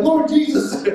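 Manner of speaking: high-pitched mock alarm; comic retold fright; alarmed exclamation. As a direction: cry out high like the startled brother.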